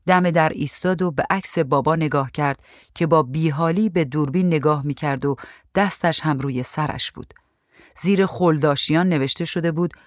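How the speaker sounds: ordinary delivery, nothing marked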